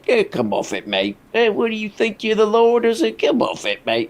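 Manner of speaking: put-on mild British accent, dismissive; a lightly done older Brit brushing him off; British come off it. Reactor imitating an older British man dismissing Russell. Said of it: "just getting the old".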